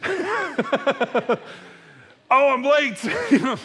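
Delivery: high laughing yelp of alarm; startled awake, laughing at himself; realising he overslept, in mock panic. Waking late after snoozing.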